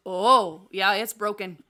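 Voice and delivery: a loud oh, then a half-laughing admission; suddenly convinced at the sight of blood; husband seeing blood, startled then sheepish; startled realization. Retold or imitated. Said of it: "And you went".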